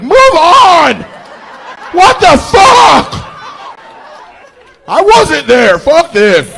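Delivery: high, loud mock outrage; annoyed audience member. Comedian voicing fed-up audience, high angry shout. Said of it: "Are there people like".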